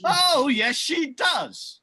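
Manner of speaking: loud pantomime retort; panto shout. Acted. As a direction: bellow it back at the crowd.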